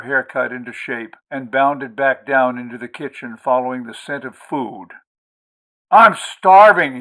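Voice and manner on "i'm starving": bursting in with a loud cry; loud exclamation. A narrator's reading. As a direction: explode into the room hungry. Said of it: "he exploded into the warm".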